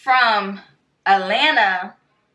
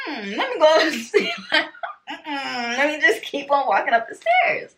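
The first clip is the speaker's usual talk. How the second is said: high-pitched, through laughter; amused retreat; 6 semitones higher than usual. Retold or imitated. Storyteller recalls slipping away upstairs, amused and laughing. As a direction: laugh while backing out of it. Retelling her retreat from the scene.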